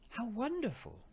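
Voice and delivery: high, loud cry of wonder; excited exclamation. A narrator's reading. Girl greeting the narrator, high and amazed. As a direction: gasp it out, astonished and delighted. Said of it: "she exclaimed".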